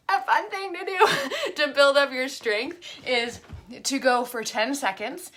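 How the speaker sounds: giggling at her own stumble; laughing through it. Real, not acted. Explaining a core exercise to viewers, laughing through the words. Cracks up on third try.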